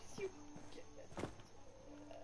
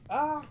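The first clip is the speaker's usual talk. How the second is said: mock death yelp; 11 semitones higher, 7 dB louder than usual. Mock shot by friend.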